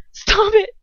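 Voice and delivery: very high, loud screamed plea. A narrator's reading. Hermione to Bellatrix as Ron is tortured, screaming. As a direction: scream it in panic. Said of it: "I scream".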